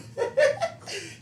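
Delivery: high squealing laugh. Real, not acted. Speaker laughs.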